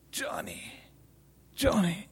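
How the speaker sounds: slow, broken, fading to a breath; crying out. A narrator's reading. Mother over her hurt son, broken crying out. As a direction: grieve over him, words falling away. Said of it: "And she cried out".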